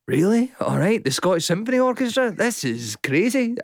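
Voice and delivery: high, incredulous "really?"; mock amazed; disbelief. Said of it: "I thought".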